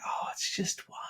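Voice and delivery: breathy whisper of an excuse; whispered temptation. Retold or imitated. The easy excuse that starts the slide, whispered. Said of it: "it is so easy to just be like".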